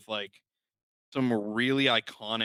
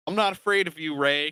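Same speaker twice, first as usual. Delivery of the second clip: higher, louder mock defiance; defiant film quote; 5 semitones higher, 5 dB louder than usual. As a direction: quote the closing line with defiant force. Quoting the film's defiant closing line.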